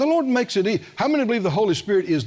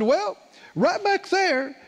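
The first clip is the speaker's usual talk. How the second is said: slow, much higher, drawn-out retort; sly comeback; 6 semitones higher, slower than usual. Retold or imitated. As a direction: lay out the trump card slowly and sweetly. Retold comeback pointing to tapes.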